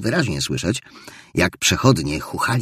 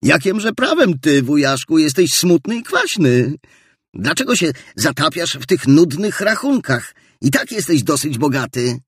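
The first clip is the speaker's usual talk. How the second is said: higher, lightly voiced young nephew teasing back; 8 semitones higher, 4 dB louder than usual. Nephew teases his grumpy uncle, light and higher. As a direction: turn the question back on him, lightly. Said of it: "odpowiedział siostrzeniec".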